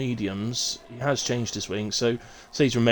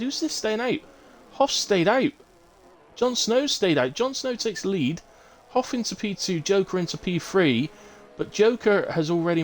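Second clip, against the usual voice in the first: higher, brisk race call; excited play-by-play; 8 semitones higher than usual. Real, not acted. Live play-by-play of pit stops.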